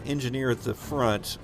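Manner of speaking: usual speaking voice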